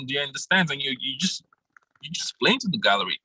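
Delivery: ordinary talk, nothing marked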